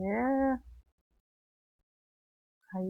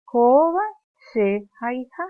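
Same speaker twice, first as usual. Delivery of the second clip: Koba called out high and loud; loud drill; 4 semitones higher, 8 dB louder than usual. Shown as a demonstration. Teacher leads class repetition, loud. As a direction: call the line out for pupils to echo. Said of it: "Y va a decir".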